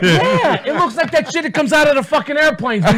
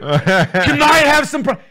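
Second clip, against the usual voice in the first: guilty defensive; 3 semitones higher, slower than usual. Retold or imitated. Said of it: "But if you're cheating".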